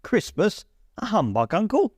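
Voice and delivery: high, rising disbelief; incredulous nephew. A narrator's reading. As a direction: toss the question up in surprise. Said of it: "said Scrooge's nephew".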